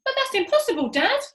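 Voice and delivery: put-on very high, loud child protest; small child monkey. A narrator's reading. Small monkey protesting to his dad, high and loud. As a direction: whine it like a little kid. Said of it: "cried one of the small monkeys".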